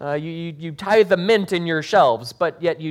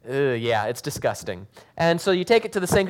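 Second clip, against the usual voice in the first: ordinary talk, nothing marked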